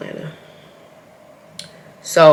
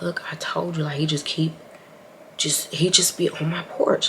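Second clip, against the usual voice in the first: very soft, near-whispered confiding; quiet, half-whispered retold remark; hushed aside; 11 dB quieter than usual. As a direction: murmur it privately to the person beside you. Confiding to new partner in car.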